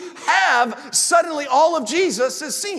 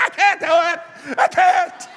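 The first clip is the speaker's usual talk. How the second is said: high, strained panicked yell; mock meltdown; 7 semitones higher, 4 dB louder, slower than usual. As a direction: explode in hammed-up despair, louder at the end. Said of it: "suddenly, like".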